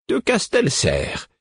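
high, slow, delighted answer; an old man answering happily; joyful. A narrator's reading. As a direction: sing out the name with glee. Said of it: "d'un ton joyeux".

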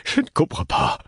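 breath-heavy plaintive cry; whispery, anguished plaint. A narrator's reading. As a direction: lament it to the heavens, voice giving out. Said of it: "au ciel d'un ton plaintif".

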